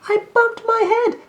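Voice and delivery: high, loud, grumpy little rabbit; sulky rabbit complaining from the well; cross little voice. Acted. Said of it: "answered the cross voice again".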